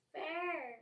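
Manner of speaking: high-pitched creature yelp; Pokemon cry. Acted. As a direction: cry out your name like a little Pokemon. Voicing Pokemon crying its name.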